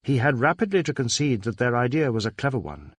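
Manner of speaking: ordinary delivery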